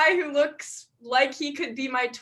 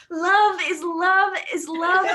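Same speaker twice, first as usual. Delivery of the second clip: put-on woke old lady; 4 semitones higher, 5 dB louder than usual.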